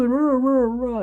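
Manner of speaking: hammed-up childish boo-hoo; playful fake crying sounds; mock sobbing. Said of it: "going home crying".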